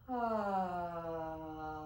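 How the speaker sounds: open-mouthed audible ah sigh; sigh of relief. Shown as a demonstration. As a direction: let out a relieved ah. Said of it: "exhale on an ah".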